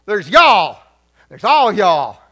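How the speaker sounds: very loud Southern drawl; Southern accent. Shown as a demonstration. Preacher teaching the plurals of you, booming Southern accent. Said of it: "bust out a little bit of Southern talk".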